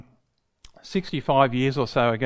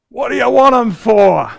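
loud ocker bellow; best bass voice; 10 semitones higher, 14 dB louder than usual. Retold or imitated. Father echoing back to the hiker, loud ocker bellow. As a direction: bellow it back like a cheeky Aussie bloke. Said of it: "In his very best bass voice".

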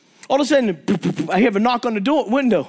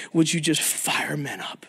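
a whisper to God; whispered prayer; 9 semitones lower, 6 dB quieter than usual. Real, not acted. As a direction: breathe it in a hush.

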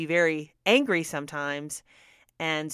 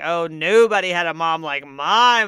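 mock lament; 8 dB louder than usual.

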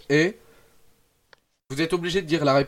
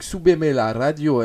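Spanish accent; 5 dB louder than usual. Shown as a demonstration. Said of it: "cet accent espagnol".

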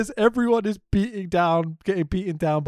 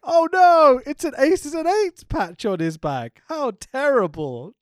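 gasped high and loud "Oh no", then slow fake horror; hammed-up fake horror; mock dismay; 8 semitones higher, 5 dB louder than usual. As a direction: ham up the shocked reaction. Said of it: "Taz turns around and like".

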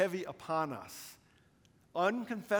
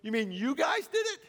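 much higher, louder, laughing disbelief; amused surprise; 10 semitones higher, 5 dB louder than usual. Retold or imitated. Dean realizing the students did it, high amused surprise. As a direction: catch him off guard, incredulous. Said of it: "he started laughing. He goes".

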